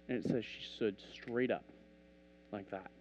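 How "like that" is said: ordinary talk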